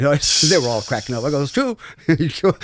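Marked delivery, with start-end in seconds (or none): 1.4-1.8 high, mock-innocent insistence; teasing squeak. Real, not acted. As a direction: squeak it out as a joke. Joking punchline to the crew.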